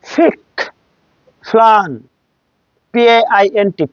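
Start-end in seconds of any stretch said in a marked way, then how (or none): 0.1-1.9 ফিক and ফ্লান, each high with an opening puff; aspirated P. Shown as a demonstration. Teacher drilling P words, aspirated ফিক, ফ্লান. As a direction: pick and plan with P like ফ. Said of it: "ফর মতো উচ্চারণ হলো".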